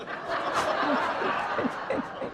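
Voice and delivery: high unvoiced chuckle at the punchline; a quick real laugh; preacher laughing at his joke; breathy laugh. Real, not acted. Speaker laughs at own joke.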